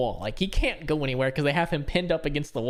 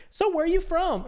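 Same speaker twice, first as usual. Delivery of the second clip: high airheaded question; mock ditzy girl; 12 semitones higher than usual. Friend imitates the girl asking again, high. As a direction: ask like a forgetful girl.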